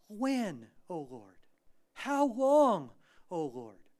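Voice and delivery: pleading cry, high and loud on the questions; psalmist's lament. Acted. Psalmist crying to God in distress, loud imploring lament. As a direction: cry out the question, then drop on the address. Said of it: "see that in the Psalms".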